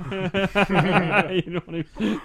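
slow, laughing question. Real, not acted. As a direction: laugh through the words.

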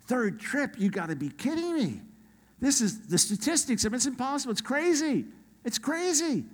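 high-pitched, rising amazed excitement; pumped up. Retold or imitated. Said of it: "I'm pretty pumped".

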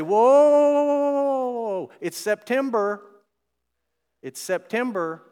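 high, loud rising whoas, then slowing down; alarmed whoa. Retold or imitated. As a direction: pump the brakes on the hype, slow and firm. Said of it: "Corey and I are gonna say".

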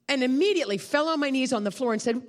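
ordinary delivery

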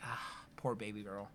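put-on soft pitying baby talk. Cooing at the coned dog, soft baby talk. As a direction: coo at a poor pup. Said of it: "and it's just like".

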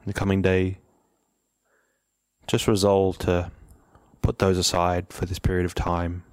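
calm, measured meditation guidance with long pauses; unhurried, even-toned meditation leader; guided meditation. Real, not acted. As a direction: ease listeners into stillness, leaving long silences between phrases.

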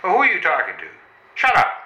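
high furious snap; angry shout. Acted. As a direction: bark at him to shut up. Snaps at servant.

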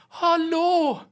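high, drawn-out call from far off; distant neighbor's call. Retold or imitated. Imitating the old neighbor calling hello through the wall. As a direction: call out a faint hello from another flat.

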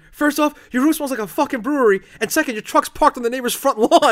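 loud, high, building to the punchline; retold father's tirade. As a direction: lay out the evidence like a yelling dad. Father delivers the proof, retold.

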